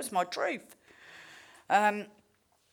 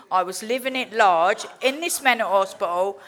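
loud, bragging about living it large; gleeful boast; 2 semitones higher, 8 dB louder than usual. Retold or imitated. To the listener, bragging about the mental hospital, loud glee. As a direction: crow about it, loud and proud.

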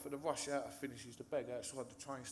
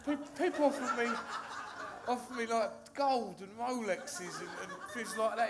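retelling the dealer's words while laughing, loud; half-laughing, can barely get the dealer's line out; laughing through the quote; 8 semitones higher, 6 dB louder than usual. Retold or imitated. Retelling the dealer turning down the leg, laughing loud throughout.

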